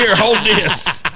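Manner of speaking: high, slow, hammed-up request; joking play-acting. Hunter jokingly hands his bow to a helper, hammed-up. Acts out handing over the bow.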